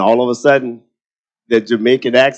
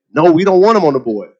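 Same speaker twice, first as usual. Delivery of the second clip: higher-pitched imitation of an objecting board; mock refusal; 6 semitones higher, 3 dB louder, faster than usual. Teasing retelling of the board turning him down, mock protest.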